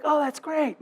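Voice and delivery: soft, muted mock enthusiasm; underwhelmed. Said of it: "I don't go low and I don't go high".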